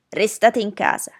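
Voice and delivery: firm narrated holler, not full force; bawled order. A narrator's reading. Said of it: "sbraitò".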